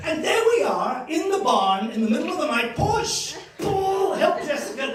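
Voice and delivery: high, loud, hammed-up lambing drama; frantic shouting. Grandchild retells helping the sheep give birth, frantic shouting. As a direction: shout it like a midnight emergency.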